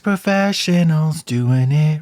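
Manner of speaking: jingle announcer. Pretend. Parody mattress ad jingle.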